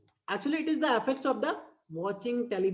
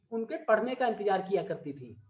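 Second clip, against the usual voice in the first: ordinary talk, nothing marked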